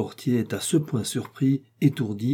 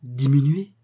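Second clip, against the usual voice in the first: stammering disbelief; 3 semitones higher, 4 dB louder than usual. Acted. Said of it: "balbutie-t-il".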